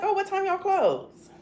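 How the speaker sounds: high, slow, falsely sweet question; sweet innocent act. Retold or imitated. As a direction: play innocent with the clerk. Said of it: "And I was like".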